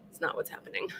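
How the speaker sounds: conspiratorial little whisper; hushed, playful denial; whispered aside. Real, not acted. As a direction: whisper it to the camera. Joking aside after sex-bunker joke.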